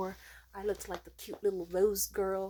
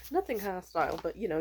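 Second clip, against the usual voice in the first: ordinary talk, nothing marked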